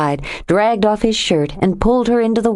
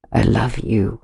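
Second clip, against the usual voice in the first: tender murmur; 8 semitones lower than usual. A narrator's reading. Tender love confession.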